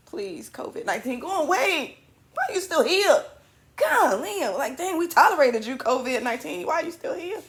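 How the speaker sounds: high, whiny plea to go away; exasperated pleading. Pretend. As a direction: beg a pest to leave. Scolding covid nineteen.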